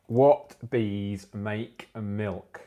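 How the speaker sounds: drawn-out riddle, each word stretched; milk the setup slowly; slow teasing riddle delivery; slow joke setup. Acted.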